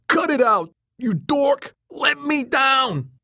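high, loud protest, drawn out at the end; furious scream. A narrator's reading. Hoisted upside down, Joey protests to Tom, furious scream. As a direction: shriek to be put down. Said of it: "Joey screamed".

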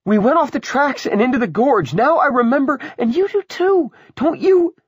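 very high, loud, mounting insistence; frantic woman. Acted. Willa insisting the mother remembers the crash, frantic. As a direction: press them frantically, climbing to a cry.

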